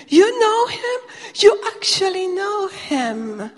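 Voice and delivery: very high and loud, astonished; can barely contain the thrill; overjoyed disbelief. Retold or imitated. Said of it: "And he got so excited".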